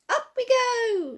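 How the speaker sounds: high, slow joyful shout as the basket lifts; a boy cheering as the ride starts; excited shout. A narrator's reading. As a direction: call it out loud and high, drawing it out. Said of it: "shouted Joe".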